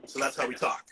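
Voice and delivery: usual speaking voice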